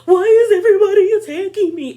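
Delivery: high, loud, laughing fake sob; hammed-up weepy complaint; mock crying whine. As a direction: whine it through tears, half laughing. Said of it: "Jackie starts crying".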